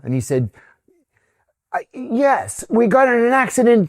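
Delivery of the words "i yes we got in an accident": high, loud retold outburst; agitated confession. Driver explaining himself to the police captain, agitated. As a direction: blurt it out in a raised, worked-up voice.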